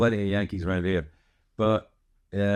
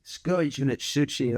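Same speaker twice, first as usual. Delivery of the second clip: put-on higher-pitched, broad Scottish accent; Scottish people teasing; 4 semitones higher than usual. Quoting Scottish people's dig at him, broad Scottish. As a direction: imitate a broad Scottish jibe. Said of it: "so Scottish people say".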